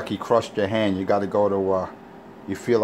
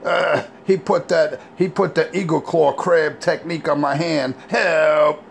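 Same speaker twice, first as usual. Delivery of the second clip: mock whiny complaint; 5 semitones higher than usual. Mocking Richie complaining to the cop. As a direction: whine it like a crushed wimp. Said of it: "Richie complaining to cop".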